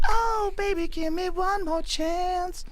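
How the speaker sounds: high falsetto singing voice. Retold or imitated. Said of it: "You got a nice falsetto there".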